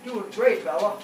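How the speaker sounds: loud, high cheer; loud encouragement. Real, not acted. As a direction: cheer the dog on loudly. Cheering dog on treadmill.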